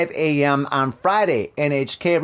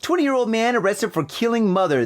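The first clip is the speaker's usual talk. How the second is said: ordinary delivery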